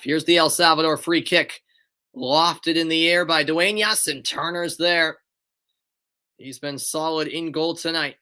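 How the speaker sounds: brisk, lifted match call; play-by-play. Acted. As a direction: call the free kick live.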